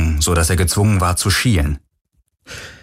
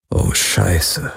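dry-throated, shocked croak. A narrator's reading. Galen, dry-throated at hearing Khan's name, croaks. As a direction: croak it out in dismay. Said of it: "krächzte er".

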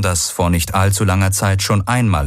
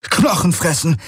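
high-pitched cry of alarm; alarmed exclamation, pitched way up; 11 semitones higher than usual. A narrator's reading. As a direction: call out a sudden warning. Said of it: "rief er aus".

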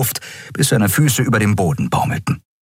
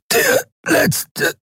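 strangled cry. A narrator's reading. Choked final words.

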